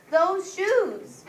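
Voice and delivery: loud, high thrilled cry; excited. Acted. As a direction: burst out with delight at the shoes. Jeremy spots the shoes.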